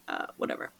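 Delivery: whatever, breathed out with no voice; under-breath whatever. Real, not acted. Mom shrugging off her labor story, whispered whatever.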